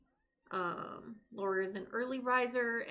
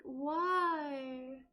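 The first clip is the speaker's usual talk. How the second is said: mock dismay; 6 semitones higher, much slower than usual. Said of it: "And I'm just like".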